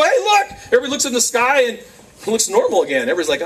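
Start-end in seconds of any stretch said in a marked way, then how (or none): 0.0-0.6 high, urgent acted call; excited calling out. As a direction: shout it to point something out. Acted watcher calling others to look.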